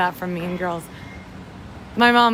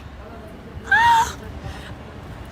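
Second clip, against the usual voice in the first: high, breathy startled gasp; voiceless startled gasp, pitched way up; sharp gasp. Real, not acted. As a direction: gasp like something just surprised you. Sudden sound on the stream.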